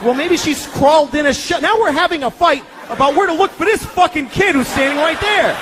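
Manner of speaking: high, heated bickering shout; exasperated shout mid-argument with wife; mock argument yell. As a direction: yell it like a marital spat. Arguing with wife over kid.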